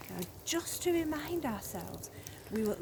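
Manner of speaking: ordinary delivery, nothing marked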